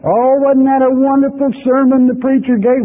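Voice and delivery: high, breathy-free gushing praise, mocking; mock a starry-eyed churchgoer raving about a sermon. Preacher mimicking a churchgoer who gushes over a sermon, high and mocking. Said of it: "people come and say".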